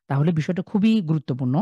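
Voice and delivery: ordinary talk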